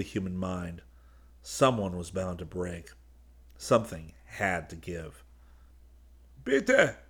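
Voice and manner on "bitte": much higher, hoarse begging; hoarse plea. A narrator's reading. A frightened gunman from the ring of guns, pleading hoarsely. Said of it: "a voice pleaded hoarsely".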